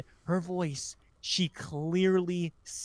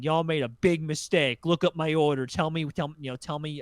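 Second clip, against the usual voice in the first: retold angry yelling; 3 semitones higher, 6 dB louder than usual. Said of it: "screaming at me".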